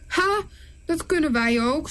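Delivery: high mocking ha; scoffing Pharaoh. Pharaoh scoffing at Aaron's miracle, mocking. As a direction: sneer it off. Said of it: "schampert hij".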